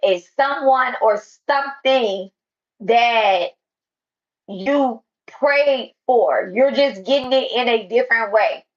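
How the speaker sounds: loud, slow preaching to a crowd. Acted. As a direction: preach it out at full force. Preaching loudly to an audience.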